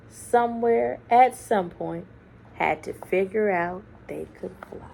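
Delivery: ordinary delivery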